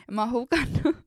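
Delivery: high-pitched laughter breaking through the words; laughing. Real, not acted. To her friend, about losing her shoes drunk, laughing. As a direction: giggle helplessly as you tell it.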